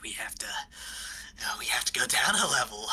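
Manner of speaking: put-on whispery, halting old miner voice; decrepit old dwarf. Old dwarf guide at the dark stairs, whispery. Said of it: "He's like".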